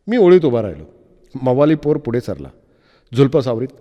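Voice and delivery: usual speaking voice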